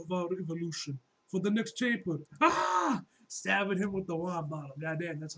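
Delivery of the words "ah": shocked cry. Real, not acted. Reacting to a stabbing panel.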